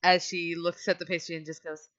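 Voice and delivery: normal talking voice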